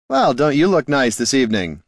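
high playful call; teasing bard. A narrator's reading. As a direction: tease her lightly. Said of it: "Heraldon called to her".

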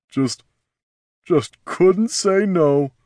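slow, high, tearful strain; slowly, on the edge of crying; close to tears. Acted. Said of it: "sounded close to tears".

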